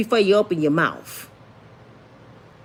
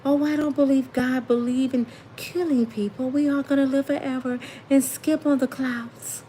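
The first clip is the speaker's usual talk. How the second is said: airy, softer, higher preacher voice, lightly done; ghostly Joel Osteen; 6 semitones higher, 4 dB quieter than usual. Retold or imitated. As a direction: float the words like a dreamy ghost. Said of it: "don't he talk like a ghost to y'all".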